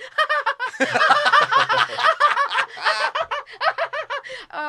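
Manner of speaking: long, loud, very high cackling giggle; hammed-up nervous laugh that will not stop; manic flirty giggle. Retelling her laugh at the handsome actor, manic giggle.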